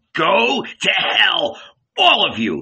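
slow, high first words, then a punched dismissal; enraged shout. A narrator's reading. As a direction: shout the curse, stretching it out. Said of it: "he shouted".

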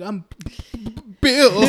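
mock stutter bursting into the name; acted stutter. Bill introducing himself to the new kid, exaggerated stutter. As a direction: get stuck, then pop the name out. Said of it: "Don't stutter my name".

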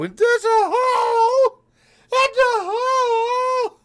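high, slow wailing cry; dramatic wail. Pretend. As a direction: wail it like a heartbroken lament. Mock lament over missing rating.

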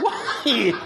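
high, laughing, exasperated plea. Real, not acted. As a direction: wail it in comic disbelief. Exasperated by pizza questions.

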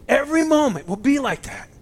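swelling with moved excitement. Real, not acted. Preacher repeating his point, choked and excited. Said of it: "Sorry, I'm getting excited, getting choked up".

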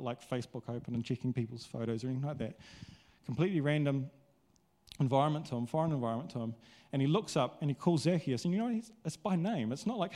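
normal talking voice

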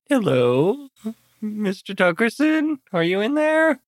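high, lilting hello to someone unseen; calling out sweetly. Acted. Visitor calls to the man inside, singsong.

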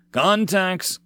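yelled warning. Acted. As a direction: shout the alert to the squad. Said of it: "the second squad leader yelled".

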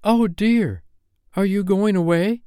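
put-on high-pitched girl's dismay; dismayed young girl. A narrator's reading. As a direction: ask it as a little girl sorry to see a friend go. Wendy dismayed at Peter leaving.

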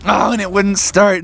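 ordinary delivery, nothing marked

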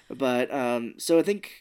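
normal talking voice

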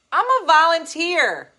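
loud, slow and high protest; indignant. Real, not acted. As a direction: stress she's only a volunteer. Indignant about cancellation.

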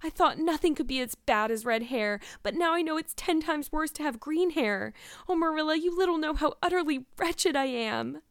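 high, wretched lament; moaning.

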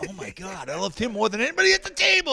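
high, loud starstruck gush; gushing fan. Retold or imitated. Diner thrilled to recognize the rapper, gushing fan reaction. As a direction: build to a high, fast burst of delight.

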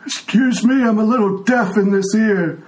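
put-on a frail old man who can't hear; old blind tiefling.

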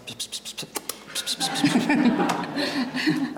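whispered psst psst to keep her awake; imitating a psst. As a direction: hiss psst repeatedly, then break into a laugh. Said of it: "I'm standing beside her going".

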